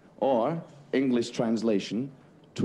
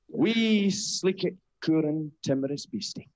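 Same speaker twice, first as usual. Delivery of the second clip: serious poem recital; 7 semitones higher than usual. Acted. Straight man tries his Burns poem again. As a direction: declaim it solemnly, pitch climbing. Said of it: "my serious poem".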